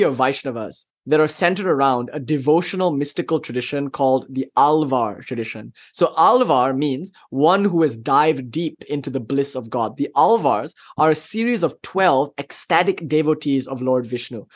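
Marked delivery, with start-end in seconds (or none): none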